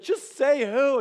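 impatient outburst. Retold or imitated. Caller won't say who they are, impatient mock outburst. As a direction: blurt it like you've lost patience. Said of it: "It's like".

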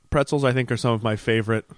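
ordinary talk, nothing marked

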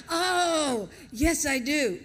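high, loud "oh" of the penny dropping; mock sudden realisation. Newcomer finally understanding the deli clerk, hammed-up realisation.

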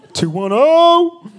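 high, loud, drawn-out hype call; hype influencer shout. Pretend. Parodying a fake influencer video, hyped shout. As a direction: belt the area code like a showoff vlogger.

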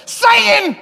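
sudden high, loud yell; mock angry outburst. Jesus turning on Peter minutes later, mock yelling. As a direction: erupt in a comic banishing shout. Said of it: "it's like ten minutes later".

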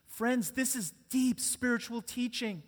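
ordinary delivery

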